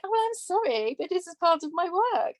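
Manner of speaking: mock apologetic. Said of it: "I'm like".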